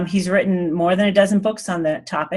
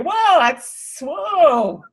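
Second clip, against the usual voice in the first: high, astonished whoa; stunned whoa; 9 semitones higher, much slower than usual. Retold or imitated. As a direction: recreate being struck by a realization. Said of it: "I was like".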